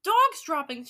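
very loud storybook yell. Acted. Witch reporting a smell to the others, yelling. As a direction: yell out the alarm. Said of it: "she yelled".

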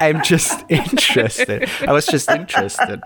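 giggly, high-pitched excuse; laughing self-defence. Real, not acted. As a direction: protest innocence while cracking up. Laughing defence of his embarrassing question.

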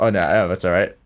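ordinary talk, nothing marked

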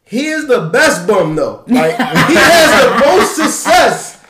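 amused laughing rant about the best bum. Real, not acted. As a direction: laugh while you explain it.

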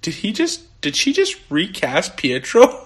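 incredulous question starting high, then slowing; shocked Darcy. Retold or imitated. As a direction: imitate someone stunned, drawing out the question. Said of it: "just as shocked as, uh, Darcy was".

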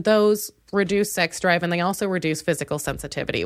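normal talking voice

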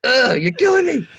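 high, groaning exasperation; mock exasperation. Reacting to the student's excuse, high mock exasperated groan. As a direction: throw up your hands, hammed-up.

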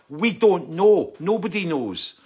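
exasperated outburst. Real, not acted. Retorting to the journalist's question.

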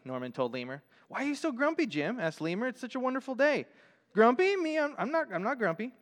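lively picture-book narration with pitch swooping high on characters' lines; animated story reading. Acted. Pastor reading a picture book to the congregation, animated storytelling. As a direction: read it like a storybook for children.

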